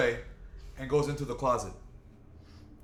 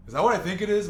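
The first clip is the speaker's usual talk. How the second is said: alarmed mom; 8 semitones higher, 7 dB louder, faster than usual. Retold or imitated. Mom spots Ouija board.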